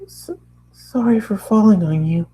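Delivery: shy pony. Acted. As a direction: stammer it out as the shy pony, high up. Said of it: "a particularly shy pony said".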